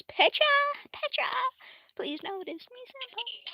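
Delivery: high-pitched mock adoring plea; pleading fangirl. Character begs Petra to notice her, high fangirl plea. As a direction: squeal it like a lovestruck anime fan.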